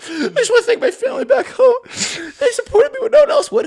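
high-pitched whiny thank-you speech; mock tearful contestant. Contestant thanking family back home, mock tearful.

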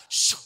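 imitated swish of a basketball net; swish sound. Narrator after another shot, imitated swish. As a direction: hush out a quick swish.

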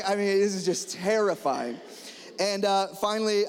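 ordinary talk

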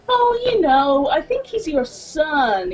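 high, slow, sugary customer voice; imitating a sweet old lady. Canadian lady telling her the guy is her son, slow and sweet. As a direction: coo it like a kindly old lady. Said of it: "she goes".

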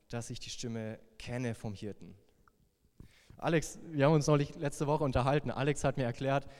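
normal talking voice

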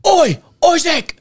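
put-on high, drawn-out Manchester-accented call. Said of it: "he grew up in Manchester".

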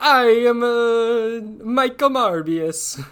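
high, slow, hammed-up Swedish chef accent; mock Swedish chef. Podcast host playing Morbius in mock Swedish chef accent. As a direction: ham up a bad Swedish chef accent as a vampire. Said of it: "Got a little Swedish chef there".

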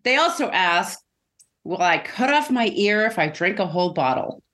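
ordinary talk

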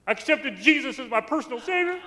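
loud, high mock-emotional declaration; imitated tearful outburst. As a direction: mimic an uncle overcome as he confesses it. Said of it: "And he starts crying".

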